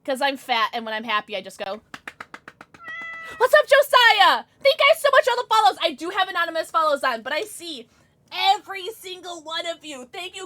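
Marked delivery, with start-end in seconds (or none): 3.4-5.8 high, loud, rushed thanks; gushing high-pitched welcome; excited shout-out. Real, not acted. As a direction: holler the greeting, then race through thanks. Greeting new followers loudly.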